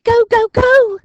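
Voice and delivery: high, loud urgent go; urgent hiss. A narrator's reading. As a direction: send them off at once. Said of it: "hissed Dinking".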